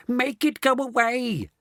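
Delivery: high, slow frightened yell. A narrator's reading. Grandma begging Mr Wonka in panic, yelling. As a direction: wail at him to make it stop. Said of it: "yelled Grandma Georgina".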